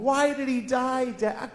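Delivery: put-on high, soft, slow child's whine; upset young daughter. Daughter asking dad about her dead fish, plaintive child voice. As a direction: imitate a little girl asking plaintively. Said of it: "my daughter comes home".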